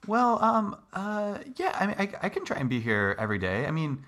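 stall, unsure how to say no; flustered hesitation. Acted. Doctor cornered by patient's demand, flustered stammer.

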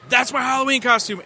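a touch of thrilled recognition; mock delight. Quotes himself thrilled at the film.

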